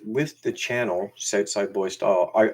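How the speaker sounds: ordinary delivery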